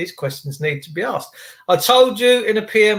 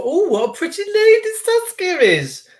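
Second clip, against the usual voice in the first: much higher, swooning praise; mock swooning; 3 dB louder than usual. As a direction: coo it out like a smitten admirer. Flirting with a viewer on stream.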